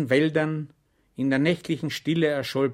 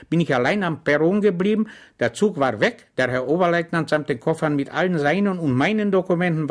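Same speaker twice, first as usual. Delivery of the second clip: higher, louder chatty soldier; Schwejk voice; 5 semitones higher, 6 dB louder than usual. Acted. Schwejk pleads innocent to the lieutenant, chatty. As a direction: tell it like an innocent. Schwejk tells his mishap.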